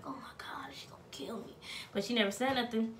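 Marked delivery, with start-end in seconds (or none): 0.0-1.5 panicked whisper. Retold or imitated. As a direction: breathe it out in a panic. Panicked inner thought, retold.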